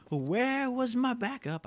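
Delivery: high, slow plaintive whine; high drawn-out whine; whiny complaint. Real, not acted. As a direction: drag it out like a betrayed teammate. Complaining about missing teammate.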